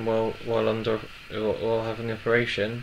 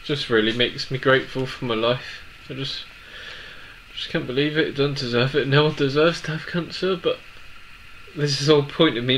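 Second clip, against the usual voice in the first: crying; 2 semitones higher than usual. Real, not acted. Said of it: "I don't know why I'm crying".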